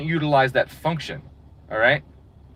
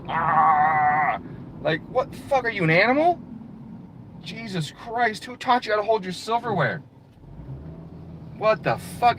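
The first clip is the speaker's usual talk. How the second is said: higher, appalled gasp and rant; mock disgust; 4 semitones higher, 3 dB quieter than usual. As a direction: act shocked at bad table manners. Disgust at fork holders.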